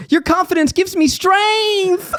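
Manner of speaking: high, slow, teary-laughing voice; hammed-up cheesy gush; sappy character voice. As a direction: gush it like a cartoon sidekick. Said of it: "one of the characters just goes".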